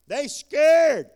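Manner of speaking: loud, high shout on scared; preacher shouting They scared to the congregation. Acted. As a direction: belt out They scared, landing hard on scared. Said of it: "They scared of him".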